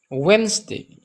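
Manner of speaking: Wednesday naik tinggi di WENS; tekanan di awal. Shown as a demonstration. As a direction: angkat nada di suku pertama Wednesday. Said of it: "Itu cara pengucapan yang betulnya". (Wednesday, suku pertama dinaikkan)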